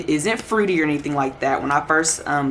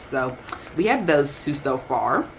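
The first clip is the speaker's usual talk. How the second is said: ordinary talk, nothing marked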